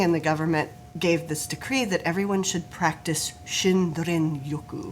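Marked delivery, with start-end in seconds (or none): 3.9-4.9 Shinrin-yoku said the Japanese way, SHEEN-reen YOH-koo; Japanese pronunciation. Real, not acted. Host praising her first try at Shinrin-yoku, carefully pronounced. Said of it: "You said it very well at first".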